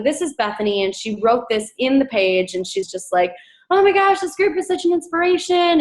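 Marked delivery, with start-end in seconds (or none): 3.7-5.8 much higher, gushing imitation of an inspired fan; excited gush. Bethany writing in the group, imitated with high excitement. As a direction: play back her thrilled comment, high and bright. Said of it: "And she's just excited".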